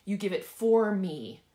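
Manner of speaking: loud, stretched "for"; forceful, drawn-out "for"; stress on FOR. Shown as a demonstration. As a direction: declare it slowly, booming on "for". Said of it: "I want you to emphasize each word".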